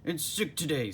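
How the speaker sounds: a lightly voiced cranky restaurant owner; Mister Krabs voice. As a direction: announce it like a put-upon boss. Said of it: "said Mister Krabs".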